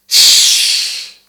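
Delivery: shh with strong rushing air; hissing shin. Shown as a demonstration. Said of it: "seperti ada gesekan". (shin dengan udara deras)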